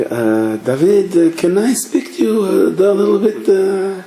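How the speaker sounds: high, restrained parental voice; strained politeness. Retold or imitated. Held-back father addresses misbehaving son.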